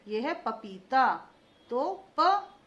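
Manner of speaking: normal talking voice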